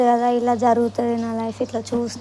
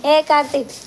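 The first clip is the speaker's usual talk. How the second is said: louder, attention-getting call; 3 semitones higher, 5 dB louder than usual. Real, not acted. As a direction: call out to someone by name. Calls Karthik over.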